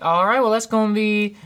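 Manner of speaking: bright high drive-thru cashier; chirpy fast-food worker voice; customer service voice. Shown as a demonstration. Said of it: "customer service voice".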